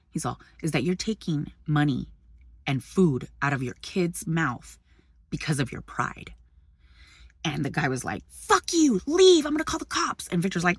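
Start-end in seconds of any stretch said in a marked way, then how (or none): 0.6-6.3 low, soft, measured imitation; totally chill. Husband shames the man over his pride, calm and low. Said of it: "Victor's totally chill". 8.3-10.3 high-pitched imitation of an angry man; mock yelling. Man orders couple out, threatening cops, mock yelling. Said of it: "the guy started yelling at him".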